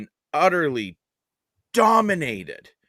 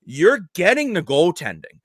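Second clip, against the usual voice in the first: raised, high-pitched rant; heated rant; 7 semitones higher, 6 dB louder than usual. Real, not acted. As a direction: push it up, arguing hard. Heated rant about coaching.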